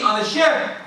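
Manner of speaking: normal talking voice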